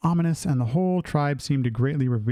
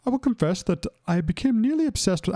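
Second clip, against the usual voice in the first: higher-pitched, badly faked British accent; 7 semitones higher than usual. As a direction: try British, pitched up and unconvincing. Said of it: "I can't even do a British accent".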